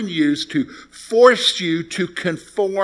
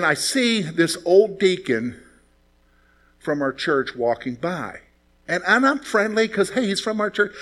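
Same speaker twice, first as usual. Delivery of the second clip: ordinary delivery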